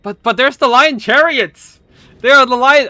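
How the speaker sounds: loud, high, thrilled shout; excited. Real, not acted. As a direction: burst out with delight. Caster spots favourite unit, thrilled.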